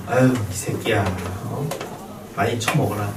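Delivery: low, soft affectionate grumble; fond mock scolding. Teacher voicing fondness for dog eating, soft mock scold. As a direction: grumble lovingly at a greedy puppy. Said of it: "이런 기분이".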